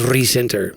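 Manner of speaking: "recenter" starting on a long trilled R; rolled R. Shown as a demonstration. Showing co-host how the narrator talks, "recenter" with a rolled R. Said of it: "I get to do the, the rolling of the R's".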